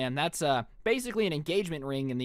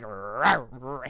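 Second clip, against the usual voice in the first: low mock doggy growl; playful, silly rawring bark; dog-collar bark; 6 semitones lower than usual. Teen in a dog collar barking at the narrator, mock growl. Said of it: "barking at you like".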